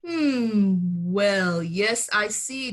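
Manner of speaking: low, slow mumble; mumbling doctor. A narrator's reading. Doctor on the phone with the father, low slow mumble. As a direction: murmur like a puzzled doctor. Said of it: "Doctor Bumble mumbled".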